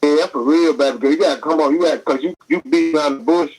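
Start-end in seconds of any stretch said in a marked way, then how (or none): none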